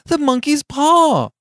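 high, loud, drawn-out cry; frantic soaring exclamation; wild cry. A narrator's reading. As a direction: shriek it wildly, stretching the words. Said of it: "she cried wildly".

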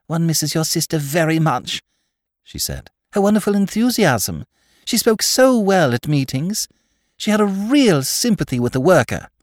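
lightly voiced earnest idealist; gushing young woman. Tarrant gushing about Mary.

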